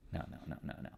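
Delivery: whispered dismayed no's. Real, not acted. Watching a stock drop, whispered dismay. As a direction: breathe the no's in disbelief. Reacting to his chart.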